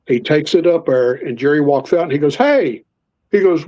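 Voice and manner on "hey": higher-pitched, indignant call; retold anger. Angry boss confronting boys over a fish, mock indignant. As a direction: imitate an angry boss calling out. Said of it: "because Jerry was mad".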